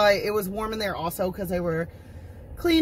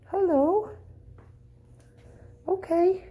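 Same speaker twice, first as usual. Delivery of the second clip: pet talk; 11 semitones higher than usual. Real, not acted. Owner greets the shelter cats in high baby talk. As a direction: gush at the kitten sweetly.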